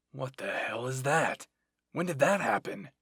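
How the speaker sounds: loud, alarmed demand; shocked outburst. Acted. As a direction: demand answers in angry shock. Levi shocked at the missing arm.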